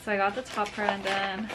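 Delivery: ordinary talk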